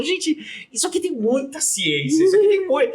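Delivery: high-pitched, thrilled outburst of discovery; excited amazement. Retold or imitated. Retelling own thrill at language.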